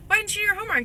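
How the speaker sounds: high gentle question. Retold or imitated. Asking kids about homework.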